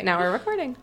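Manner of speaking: ordinary talk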